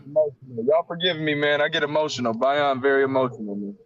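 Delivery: emotional. Real, not acted. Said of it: "I'm emotional".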